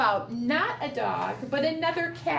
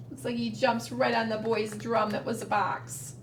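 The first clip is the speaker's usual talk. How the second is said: ordinary delivery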